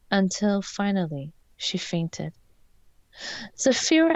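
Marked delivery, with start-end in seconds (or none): 3.6-4.2 high, loud scream of a name. A narrator's reading. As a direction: call out her name in alarm. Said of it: "I screamed".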